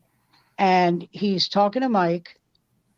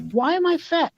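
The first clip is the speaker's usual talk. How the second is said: high mock whine of the fat guest; mock whiny question; 10 semitones higher than usual. Guest asking Newhart why he's fat, mock whine. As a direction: squeak it out like a complaint.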